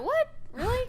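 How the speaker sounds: high-pitched mock disbelief; incredulous retold reaction; retold surprise. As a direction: act out being taken aback, voice jumping up. Acts out own surprised reaction.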